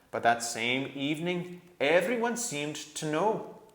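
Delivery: calm them with patient faith; patient badger. A narrator's reading. Truffle Hunter.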